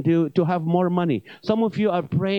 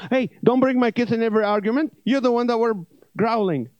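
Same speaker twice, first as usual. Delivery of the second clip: defensive snap; 5 semitones higher, faster than usual. Acted. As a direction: snap back like a cornered husband. Owner fights back at stomach.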